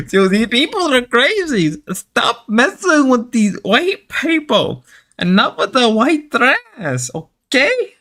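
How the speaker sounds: put-on high, drawn-out Mexican-accented scolding; mock Mexican accent. Telling him to stop messing with crazy people, mock Mexican accent. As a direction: plead in an exaggerated Mexican accent, pitched high. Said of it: "I gotta stop doing the Mexican voice".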